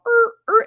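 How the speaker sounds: very high loud er er; mock scanner beeps. Mocking cashiers, very high scanner noises. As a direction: imitate a scanner beep. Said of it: "You're literally just going".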